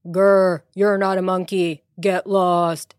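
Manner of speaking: growly retold dismissal; mimicking monkey king. Daughter retelling the monkey's words to mother, mimicking. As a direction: growl a mock version of the king. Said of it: "And then he said".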